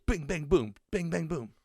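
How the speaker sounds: loud, high bing-bang-boom effects; imitated fight noises. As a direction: punch out comic battle sound effects.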